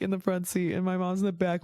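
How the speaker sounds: ordinary talk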